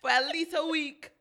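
laughing through the joke. Real, not acted. Host teasing about her Jamaica trip, laughing. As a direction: crack up while telling it.

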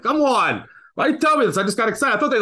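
exasperated. Retold or imitated. Friend's false touchdown report.

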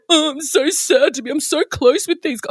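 lightly voiced mock whine; mocking sappy contestant. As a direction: parrot the contestants' gushing. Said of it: "they all go".

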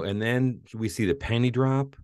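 usual speaking voice

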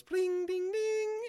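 high chiming imitation of a phone jingle; sing-song ding like a bedtime alarm; tinkly mock phone chime. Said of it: "Do the little noise that your phone makes".

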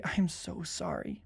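soft, slow sympathy; hushed, tender consoling; acted condolence. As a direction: offer comfort gently and slowly. Models consoling a grieving person.

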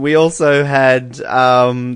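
normal talking voice

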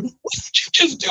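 imitating a stunned wife, high-pitched; stunned disbelief. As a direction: ask in mock shock. Wife reacting to his phone call.

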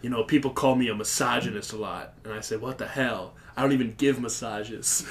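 deep, soft macho whisper; a low, breathy tough-guy murmur; manly ASMR voice. Retold or imitated. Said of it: "Iggy Manley, it's like".

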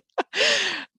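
high delighted laugh; a big high laugh. Real, not acted. Laughs at crutches story.

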